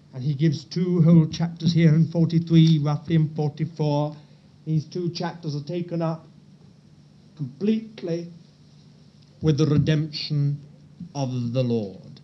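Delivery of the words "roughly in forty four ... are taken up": ordinary delivery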